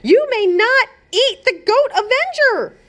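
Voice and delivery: high, firm princess order; commanding princess. A narrator's reading.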